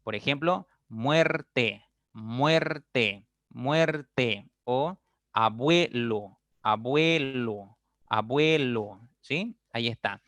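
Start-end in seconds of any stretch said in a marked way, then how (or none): 0.9-4.5 "muerte" split into muer-te, stretched; slow pronunciation demo. Shown as a demonstration. Teacher giving a U-E example, "muerte" syllable by syllable. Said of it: "con la unión de U E". 5.3-9.0 "abuelo" drawn out on "bue"; slow pronunciation demo. Shown as a demonstration. Teacher showing the mouth opening, "abuelo" stretched. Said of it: "cuando tú pronuncias la vocal abierta".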